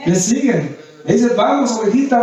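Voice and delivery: ordinary delivery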